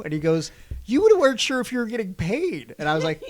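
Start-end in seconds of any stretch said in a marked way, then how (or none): 0.8-2.7 higher, louder mocking voice; mock teasing friend. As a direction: needle him in a raised teasing voice. Friend teasing him in the van.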